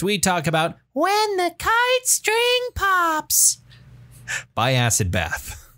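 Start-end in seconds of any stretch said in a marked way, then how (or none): 0.9-3.6 high, slow creepy clown voice; Pogo the Clown voice. Acted. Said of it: "That's my Pogo the Clown voice".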